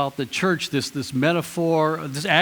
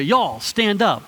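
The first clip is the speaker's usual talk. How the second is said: slow, "Y'all" drawled then stand up; Southern y'all command; 4 semitones higher, slower than usual. Shown as a demonstration. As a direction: call the row up Texas-style. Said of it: "I'd say".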